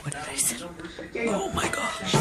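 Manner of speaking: soft unvoiced whisper. Real, not acted. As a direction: breathe it out, barely audible.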